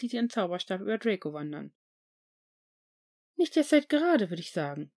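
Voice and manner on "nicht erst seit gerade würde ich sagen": higher, lightly voiced stern matron; a strict nurse scolding mildly. A narrator's reading. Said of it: "sagte sie streng". (strenge Krankenschwester, höher gesprochen)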